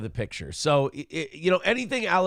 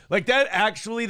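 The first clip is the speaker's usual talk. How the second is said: normal talking voice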